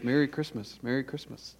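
normal talking voice

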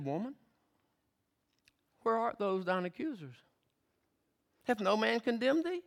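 soft, gentle question; tender Jesus. Acted. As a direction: lift her up with your voice. Jesus speaking tenderly to woman.

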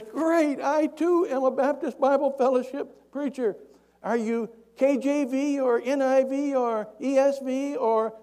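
high, loud acted holler; sustained long-distance shout; yelling. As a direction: call up to a man far above. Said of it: "He yells up at him".

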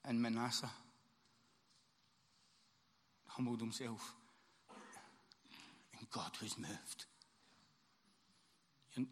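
soft, low, unhurried recitation; hushed reading to the congregation; quiet devotional reading. Acted. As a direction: read it gently like a closing poem. Quiet reading before the sermon.

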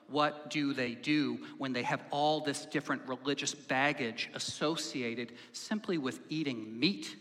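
usual speaking voice

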